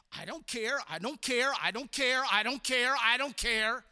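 repeated insistently, building in volume; mounting, stubborn dismissal; defiant indifference. Acted. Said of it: "you're just kind of saying this".

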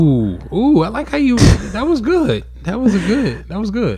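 high, excited hooting approval; impressed praise. Real, not acted. Praising the partner's clever comeback.

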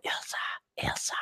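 hushed, whispered search call; mock stealthy whisper for a lost child. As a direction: whisper the name as if hiding. Acting out searching quietly in hiding.

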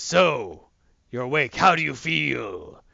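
lightly voiced comic-book villain; slow, hammed-up greeting on the last words; King Shazam. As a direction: draw the question out with mild menace.

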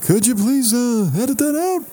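high Seinfeld-style plea; mock Seinfeld asking politely; Seinfeld impression. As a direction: whine it like Seinfeld. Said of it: "I could do a pretty good Seinfeld".

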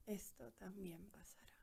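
soft whispered echo; whispered mantra. Real, not acted. Listener repeats mantra in meditation.

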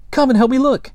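very high, loud monkey voice calling; excited monkey call. A narrator's reading. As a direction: summon your friend, squeaky and eager. Monkey calls Sniff over.

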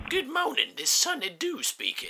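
put-on a lightly high, soft old man answering; old man on phone. Old Sonny Doo answering Howard's call, wobbly old voice. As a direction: answer the phone as a doddery ancestor.

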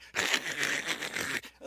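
long rushing hiss of water; imitated fire hose blast. Fire hose turns on at the bucket, imitated whoosh. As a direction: make the noise of a gushing hose.

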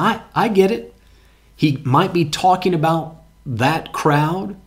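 ordinary talk, nothing marked

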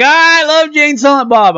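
high, excited mock exclamation; imitated fan gushing. Friend dying at the film cameo, imitated as high gushing. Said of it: "Russo's dying. He's like".

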